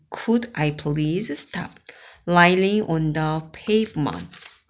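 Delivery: usual speaking voice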